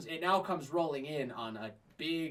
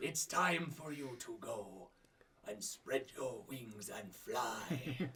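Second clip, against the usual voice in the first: slow, solemn mentor farewell; slow, measured sage voice; wise old master; 2 semitones lower, 5 dB quieter, slower than usual. Acted. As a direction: send your pupil off gently, with long pauses. Old master sends his pupil off.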